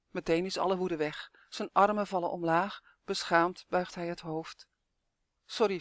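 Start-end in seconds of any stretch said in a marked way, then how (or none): none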